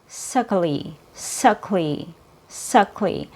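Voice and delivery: soft, quick unstressed syllables. Shown as a demonstration. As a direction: say the weak ending lightly and softly. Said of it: "a little bit quieter".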